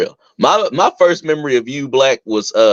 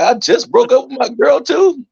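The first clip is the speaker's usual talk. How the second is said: eager; 12 semitones higher than usual. Retold or imitated. Retold eager thought.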